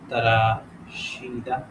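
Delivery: very slow, stretched تراشیدہ; sounded out slowly. Shown as a demonstration. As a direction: draw تراشیدہ out syllable by syllable. Sounding out the answer word.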